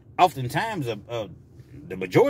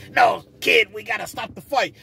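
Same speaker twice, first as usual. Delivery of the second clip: higher, urgent boxing-trainer imitation, loud first words; Mick; 7 semitones higher, 4 dB louder than usual. Trainer to hurt boxer, pleading to stop. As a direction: cry out to halt the bout. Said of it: "and, and Mick".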